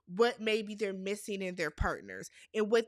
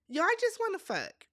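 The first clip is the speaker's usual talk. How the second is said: blunt callout; 8 semitones higher than usual. Retold or imitated. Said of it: "And I'm like".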